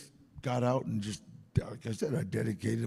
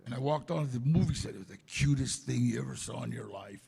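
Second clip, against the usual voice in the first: ordinary talk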